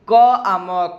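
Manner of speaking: teacher sounding out "come". Shown as a demonstration. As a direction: break "क आ म" into its sounds. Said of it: "इसको हम पढ़ेंगे".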